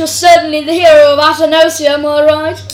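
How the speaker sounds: put-on loud, hearty Russian-accented flattery; hammed-up Russian lord, loud; lord's Russian accent.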